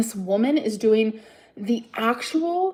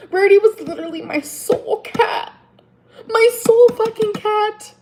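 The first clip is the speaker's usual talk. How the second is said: higher and louder, voice straining on the last words; emotional outburst; 4 semitones higher, 4 dB louder than usual. Real, not acted. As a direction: cry out over the lost cat. Grief-fuelled outburst about cat.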